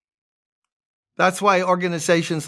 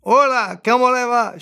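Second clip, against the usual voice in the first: imitating wife calling out; 6 semitones higher than usual. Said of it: "She's yelling out to people as she's speaking Spanish".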